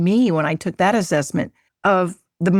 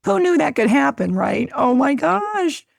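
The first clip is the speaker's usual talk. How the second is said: delighted amazement; 6 semitones higher than usual. Real, not acted. Host reacting to the guest's story of acceptance, delighted amazement. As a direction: gush in real amazement, pitched up high.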